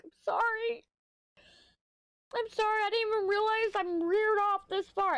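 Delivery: squeaky, very high mock-apologetic voice; high-pitched apology. Speaker apologizing to exasperated friend, acted out high and loud. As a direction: plead sorry in an exaggerated high squeal.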